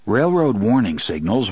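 usual speaking voice

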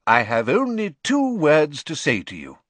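lightly voiced loud, grand address; deep-voiced headmaster. A narrator's reading. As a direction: address the hall with mild ceremony. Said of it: "his deep voice echoing around the hall".

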